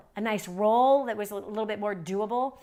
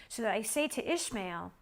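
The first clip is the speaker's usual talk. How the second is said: normal talking voice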